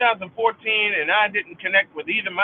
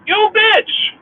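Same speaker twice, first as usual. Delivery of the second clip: very high, loud scream; furious shout; 12 semitones higher, 6 dB louder than usual. Real, not acted. Cursing the sister to listeners, furious shout. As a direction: scream it at her.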